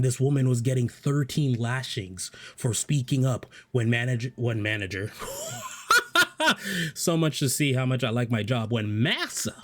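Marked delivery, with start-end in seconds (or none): 5.2-6.9 high burst of laughter; a sudden laugh that breaks out; a real laugh at his own dark joke. Real, not acted.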